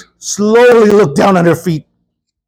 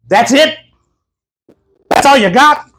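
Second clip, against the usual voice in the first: mock indignant, pitched up in disbelief; angry little lady; 6 semitones higher than usual. Woman unimpressed by the pastor's prayer, mock indignant. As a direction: imitate an offended woman, higher on the second question. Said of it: "Angry little lady, man, very angry".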